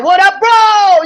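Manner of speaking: loud, slow, high "What up, bro?"; casual greeting. Retold or imitated. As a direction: call out to your buddy. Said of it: "like, a saying".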